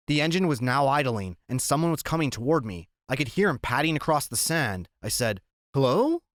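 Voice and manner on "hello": high, rising tentative greeting; questioning call. A narrator's reading. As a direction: call out to see who is there. Boy calls to unseen man.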